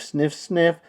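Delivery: usual speaking voice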